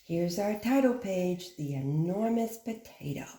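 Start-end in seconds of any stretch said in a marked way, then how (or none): none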